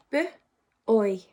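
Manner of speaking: ordinary talk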